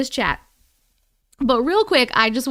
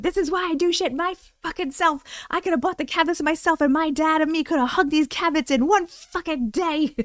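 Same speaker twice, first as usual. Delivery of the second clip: higher-pitched, worked-up complaint; retold frustrated rant; 5 semitones higher than usual. Streamer retelling rant about cabinets to uncle, frustrated. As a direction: vent in a pitched-up, fed-up run. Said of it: "I was like".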